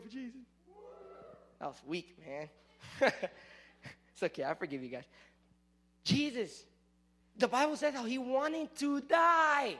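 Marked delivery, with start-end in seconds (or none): none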